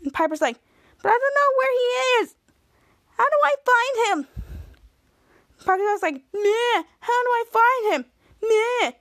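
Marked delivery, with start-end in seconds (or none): 1.0-4.3 high, helpless complaint; whiny pleading. Retold or imitated. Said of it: "That's how you sound". 6.3-8.9 high, bleating mimicry of a whiner; mock someone's complaint with whiny noises; mocking whine. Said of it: "That's how you sound".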